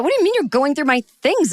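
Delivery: high-pitched, affronted protest; mock indignation. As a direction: protest the injustice, hammed up. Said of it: "the injustice of it".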